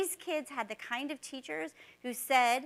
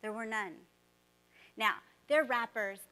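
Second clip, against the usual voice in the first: normal talking voice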